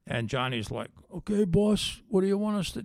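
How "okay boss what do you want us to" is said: higher, eager yes-man driver; mob driver. Retold or imitated. Driver Johnny answering his boss, a hammed-up wiseguy flunky. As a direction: play the loyal wiseguy driver.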